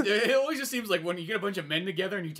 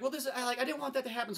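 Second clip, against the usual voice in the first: put-on girl's voice; 9 semitones higher, faster than usual. Girl regrets, voiced by teller.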